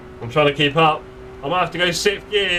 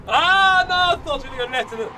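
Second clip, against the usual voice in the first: startled yelp; 11 semitones higher than usual. Real, not acted. Near crash while sim racing.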